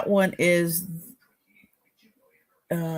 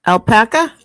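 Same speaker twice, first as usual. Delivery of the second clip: Boston accent, loud and rising; 4 semitones higher, 9 dB louder than usual. Shown as a demonstration. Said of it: "My Boston accent".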